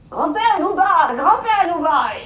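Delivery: slow, loud, odd ogre call; ogre's strange voice. Acted. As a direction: call slowly in a voice that won't pass. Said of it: "encore une voix bizarre".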